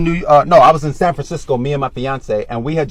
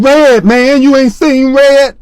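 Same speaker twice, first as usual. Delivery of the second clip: high, loud and slow on Red; crazy street insistence; 8 semitones higher, 6 dB louder, slower than usual. Retold or imitated. To the homeless man, high and loud, slow insistence. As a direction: lean in, act cuckoo. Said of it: "pressing the homeless men".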